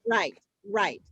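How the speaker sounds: ordinary delivery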